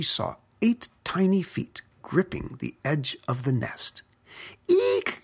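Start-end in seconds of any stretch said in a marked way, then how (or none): 4.7-5.2 much higher startled shriek; a mother bird's shocked squeal; short high shriek of alarm; shrieked eek. A narrator's reading. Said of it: "she cried".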